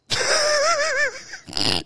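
real high cackle; high laugh. Real, not acted.

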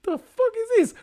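high, startled outburst; shocked. Retold or imitated. Worker seeing a mop in a suit, high startled outburst. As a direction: squeak it out in shock. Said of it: "And he's like".

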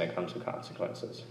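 a hushed, weighty repeat; whispered echo. Shown as a demonstration. Preacher echoing his warning to the congregation, whispered. Spoken then echoed.

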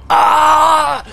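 loud high scream of pain. Acted. GM voicing the pinned enemy, a loud high scream. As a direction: howl as the blade pins him down.

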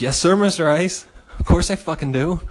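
eager yes. Retold or imitated. Eager reply to lunch invite.

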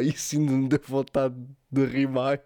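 usual speaking voice

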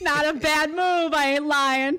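loud, slow, emphatic approval; impressed praise. Real, not acted. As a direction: praise it loudly like you're genuinely impressed. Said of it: "Plugging your own content".

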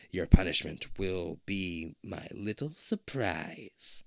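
slow creepy whisper; menacing whisper. A narrator's reading. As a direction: whisper the threat slowly. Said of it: "she trails off".

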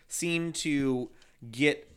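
ordinary talk

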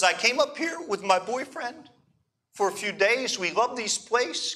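put-on tearful Hebrew accent. Crying girl explaining to the pastor, imitated Hebrew accent. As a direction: mimic a young Israeli woman telling her story, Hebrew accent. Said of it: "she's crying and she tells me this story".

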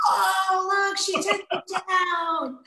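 high, drawn-out mock crowd shout; amazed onlookers. Retelling the rest of the wrestlers' reaction, amazed high mock shout. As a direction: imitate a crowd's stunned cry.

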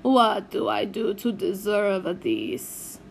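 slow Italian lament; a lightly voiced weary uncle; despairing Italian uncle. A narrator's reading. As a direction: uncle lamenting his lot.

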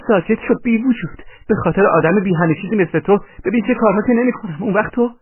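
high-pitched angry tirade; shrewish, high scolding; furious woman's scolding. A narrator's reading. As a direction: berate him in a high character voice. Woman scolds while hitting man.

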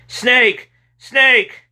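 high, loud yelled warning; James yelling. A narrator's reading. Boy yelling to his friend about the snake, panicked. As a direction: yell it out high, one cry at a time. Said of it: "James started yelling".

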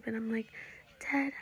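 ordinary delivery

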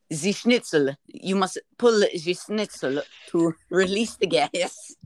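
hammed-up German accent; German-accented parody voice; mock German accent. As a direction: instruct in a fake German accent. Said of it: "how do you do German accent".

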